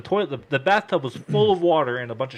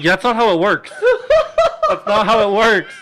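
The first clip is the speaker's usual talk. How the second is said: louder through laughter; laughing exasperation; 9 semitones higher, 9 dB louder than usual. Retold or imitated. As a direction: laugh it off incredulously. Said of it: "laughing at cousin".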